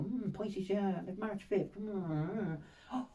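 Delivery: slow, hushed musing; musing stranger. Retold or imitated. Stranger checking dates in his books, slow hushed musing. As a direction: hum over the book like a mystic.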